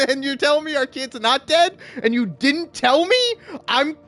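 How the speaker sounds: high, incredulous acted tirade, slowing toward the end; pissed-off scolding. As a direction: scold a spouse in hammed-up outrage. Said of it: "I'd be so pissed".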